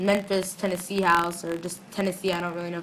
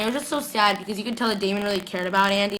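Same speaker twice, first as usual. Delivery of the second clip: usual speaking voice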